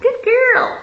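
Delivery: high, mocking echo of his words; mocking repeat. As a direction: sneer it back in a high squeal.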